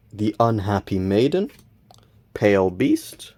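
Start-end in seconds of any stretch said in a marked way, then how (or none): 2.3-3.3 voice cracking. Real, not acted. Reading out pulled cards to viewers, voice cracking. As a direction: voice breaks reading the name. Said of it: "my voice completely cracked there".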